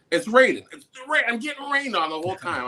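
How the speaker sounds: high-pitched, exasperated whine about being rained on; annoyed complaint. Retold or imitated. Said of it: "I'm just annoyed".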